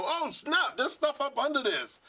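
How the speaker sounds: discover a hidden layer with a jolt; surprised. Pretend.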